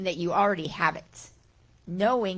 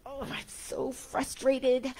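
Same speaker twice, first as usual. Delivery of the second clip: slow, higher, whiny imitation of frustration; mock frustration; 6 semitones higher, slower than usual. Teacher imitating a frustrated person, mock whine. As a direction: play the frustrated self, drawn out. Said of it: "We feel".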